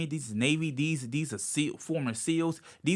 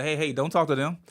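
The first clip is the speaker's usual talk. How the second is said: higher and louder, warning; protective mom; 8 semitones higher, 6 dB louder than usual. Retold or imitated. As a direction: warn them off like a protective mother. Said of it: "my mom be like".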